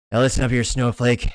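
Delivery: put-on tough movie cowboy voice; hammed-up tough guy laying down a warning; Clint Eastwood impression. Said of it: "do a Clint Eastwood accent".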